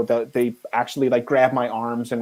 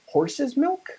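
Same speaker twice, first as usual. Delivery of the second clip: high startled rising "horse's milk"; confused question; 13 semitones higher than usual. Retold or imitated. Crew member misunderstanding the fixer, high confused question. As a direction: sound baffled.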